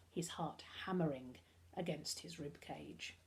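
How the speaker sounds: hushed suspense whisper. A narrator's reading. Suspense, ending the chapter.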